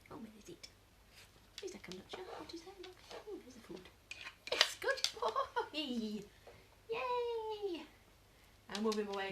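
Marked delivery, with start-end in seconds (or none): 0.1-3.8 hushed whisper. Real, not acted. Owner whispering while the dog searches, hushed. 4.5-7.8 very slow high praise then a squeal; excited praise. Real, not acted. Owner cheering her dog on, slow and high.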